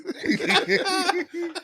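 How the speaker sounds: high, crying-laughing fit; helpless laughter. Real, not acted. Teller telling the piss story to friends, crying with laughter. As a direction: lose it completely, laughing to tears.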